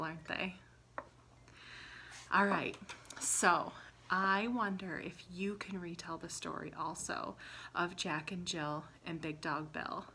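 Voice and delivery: ordinary talk, nothing marked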